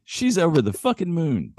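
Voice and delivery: high-pitched mock delight; hammed-up joy; delighted punchline. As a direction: read it as a gleeful new dad. Reading joke punchline in character.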